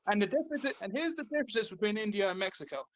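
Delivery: usual speaking voice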